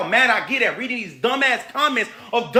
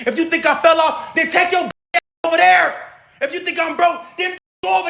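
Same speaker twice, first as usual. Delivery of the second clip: angry shout; 6 semitones higher than usual. Real, not acted. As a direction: shout them off your page. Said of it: "'cause I'm so mad right now".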